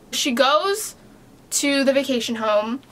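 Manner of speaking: ordinary talk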